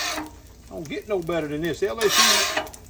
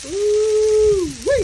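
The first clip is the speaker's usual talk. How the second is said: delighted whoop; 13 semitones higher, 7 dB louder than usual. Real, not acted. Delight at the cooking smell.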